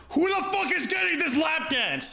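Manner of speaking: high, shouted complaint of an annoyed stripper; irritated yell. Retold or imitated. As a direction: yell it out, fed up.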